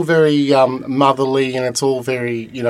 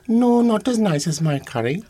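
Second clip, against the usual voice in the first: mother's aside; 8 semitones higher than usual. Retold or imitated. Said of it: "my mother would say".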